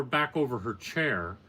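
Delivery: ordinary delivery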